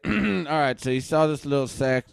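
ordinary delivery

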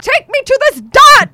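high, loud, slow frantic demand; frantic yell. Retold or imitated. Retelling the panic to a cab driver, loud frantic demand.

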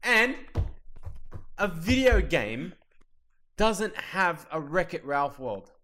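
exasperated complaint, high and emphatic; comic outrage. Real, not acted. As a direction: rant like a let-down fan. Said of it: "oh, I'm so mad".

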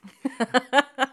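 bursting high laugh. Real, not acted. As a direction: let a big laugh out. After pastor story punchline.

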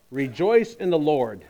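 ordinary talk, nothing marked

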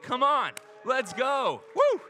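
loud high whoop of joy; excited cheer. Real, not acted. Pastor celebrating the accepted offer, loud cheer. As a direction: shout it out, celebrating.

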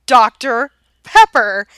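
DOCTOR PEPPER said slowly, word by word; slow, deliberate repeat. Retold or imitated. Husband repeating his drink order, slow and deliberate.

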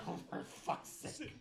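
exasperated under the breath; muttered groan. Acted. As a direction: mutter it like a fed-up guard. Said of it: "they're literally like".

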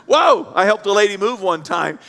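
usual speaking voice

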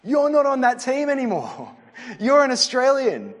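coach calling player over. Retold or imitated. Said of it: "He'd be calling Daniel Arzani over to the side and be like".

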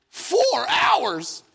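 high, incredulous mock outcry; shocked disbelief. Hears of the four-hour wait, mock-horrified disbelief.

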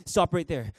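usual speaking voice

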